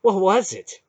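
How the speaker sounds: high, loud frightened question. Acted. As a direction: ask it in alarm. Frightened Dernick asks.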